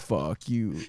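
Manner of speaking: a hint of a demon growl. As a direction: imitate the parrot's demon voice. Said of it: "in a demon-ass voice".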